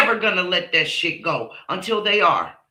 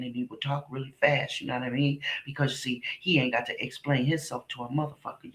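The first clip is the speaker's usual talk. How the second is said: low, soft and fast mumble; smooth-talking player; 5 semitones lower, 7 dB quieter, faster than usual. Retold or imitated. Imitating Richard sweet-talking the women, low and fast. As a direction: murmur the come-on low and quick. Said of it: "He would talk like this".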